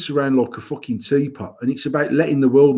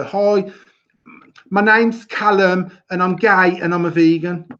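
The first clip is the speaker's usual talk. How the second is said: mocking announcement; 4 semitones higher, slower than usual. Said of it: "feel the need to go".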